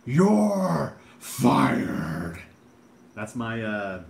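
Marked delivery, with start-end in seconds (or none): 0.0-2.4 big loud boss bellow; Vince McMahon impression. Chat asks for Vince McMahon, he bellows the firing line. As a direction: boom it out like a furious wrestling boss. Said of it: "Vince McMahon impression".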